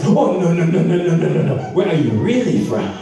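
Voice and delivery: the friend insists, in a mock African accent. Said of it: "he comes up to me".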